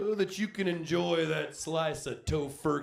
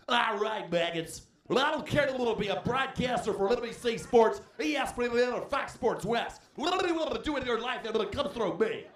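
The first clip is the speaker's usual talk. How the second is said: higher, fast barking drill instructor; drill sergeant; 5 semitones higher than usual. Retold or imitated. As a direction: bark at recruits like a sergeant. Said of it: "like boot camp for your mouth".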